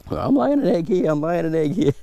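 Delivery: very high squeaky hen voice; voicing a hen. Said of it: "that's their sort of egg talk".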